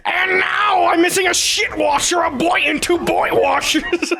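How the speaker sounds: high shouted crying cartoon voice; YouTube poop quote. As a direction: wail it like the cartoon kid. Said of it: "he says".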